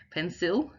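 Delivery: "pencil" with a full I, pen-SIL; every letter sounded in "pencil"; spelling pronunciation. Shown as a demonstration. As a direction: say "pencil" as spelt, ending in a clear "sil". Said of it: "you can hear all the letters and all the sounds".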